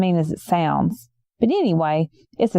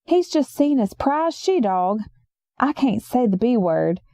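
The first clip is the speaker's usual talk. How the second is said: usual speaking voice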